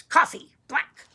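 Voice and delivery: loud, curt order; grouchy old man. Shown as a demonstration. Showing the child the old man's order, grouchy. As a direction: punch out both words grumpily. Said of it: "Kind of a low voice".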